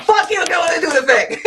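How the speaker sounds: high, laughing mock coach bark; football coach cat. Coach laughing at her blunt coaching self, high mock bark. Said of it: "football coach cat coming out".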